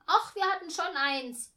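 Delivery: put-on high, loud child voice; brash kid's objection. A narrator's reading. Boy corrects his sister about the baby's bed, loud and brash. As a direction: chip in loudly to correct.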